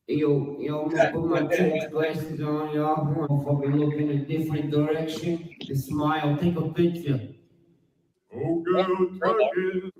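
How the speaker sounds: lightly put-on voice of a vain poser; mocking Adam. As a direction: make fun of a guy posing for a photo. Mocking Adam posing in glasses.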